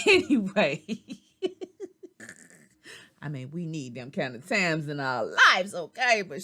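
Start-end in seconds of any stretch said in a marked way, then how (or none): 0.1-3.2 bursting laugh. Real, not acted. Laughs after party story.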